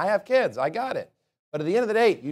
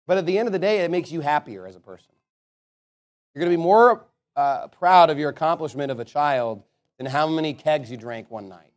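ordinary talk, nothing marked

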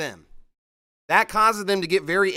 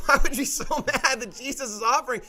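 laughing through the question; laughing disbelief; 5 semitones higher than usual. Real, not acted. Preacher laughing at the woman's anger, incredulous. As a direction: chuckle at how absurd it is.

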